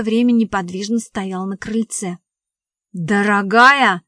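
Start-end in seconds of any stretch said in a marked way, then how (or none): 3.0-4.0 loud, slow, incredulous question; indignant echo. A narrator's reading. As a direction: throw the endearment back in outrage. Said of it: "зашёлся от негодования Шапкин". (возмущённо переспросить, громко и протяжно)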